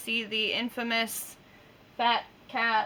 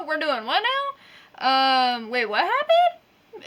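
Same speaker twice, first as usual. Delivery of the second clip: puzzled, rising huh; acting baffled while reading; confused huh; 6 semitones higher, 5 dB louder, slower than usual. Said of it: "like, going".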